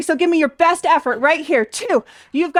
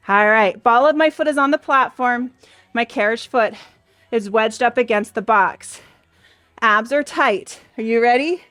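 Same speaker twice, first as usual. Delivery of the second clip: normal talking voice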